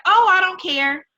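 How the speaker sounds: mock dismissive. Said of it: "like you, was like".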